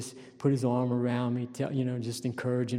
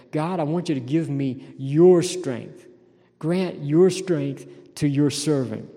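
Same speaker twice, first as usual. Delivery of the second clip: slow, loud earnest plea; fervent psalm plea; pleading as David; 3 semitones higher, 7 dB louder, slower than usual. Acted. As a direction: implore God for strength. Preacher voicing David's plea.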